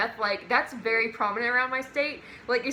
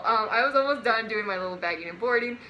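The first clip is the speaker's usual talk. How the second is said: ordinary talk, nothing marked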